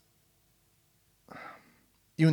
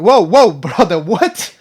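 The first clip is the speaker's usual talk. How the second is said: shocked whoa; 4 semitones higher, 13 dB louder, slower than usual. Retold or imitated. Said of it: "I'm like".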